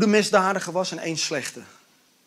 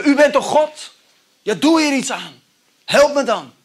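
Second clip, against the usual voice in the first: demanding outburst; 9 semitones higher, 7 dB louder than usual. Retold or imitated. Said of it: "En dan zeggen we".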